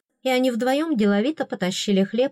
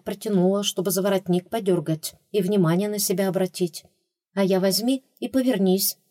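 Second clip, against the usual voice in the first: usual speaking voice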